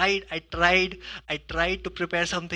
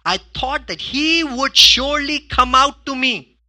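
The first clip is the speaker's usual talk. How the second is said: louder, higher, slow indignant complaint; offended Naaman; 7 semitones higher, 9 dB louder, slower than usual. Acted. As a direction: stretch out Naaman's hurt pride. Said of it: "this is Naaman saying".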